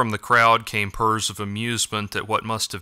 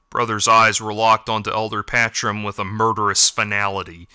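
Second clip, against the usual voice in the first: ordinary delivery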